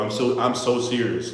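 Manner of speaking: ordinary talk, nothing marked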